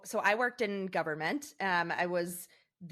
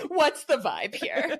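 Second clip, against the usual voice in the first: laughing mock question; 7 semitones higher, 7 dB louder, slower than usual. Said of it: "I'm like".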